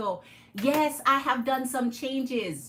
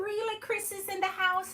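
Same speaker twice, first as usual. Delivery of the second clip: ordinary talk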